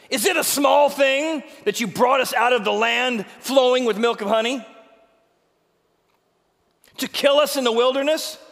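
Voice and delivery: angry accusation. Acted. Rebels accusing Moses, shouting angrily. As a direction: hurl the accusations at him.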